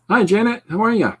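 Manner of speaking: high, loud singsong hello; cheery greeting. Real, not acted. Streamer greets Janet in a high, loud singsong voice. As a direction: welcome her warmly and loudly.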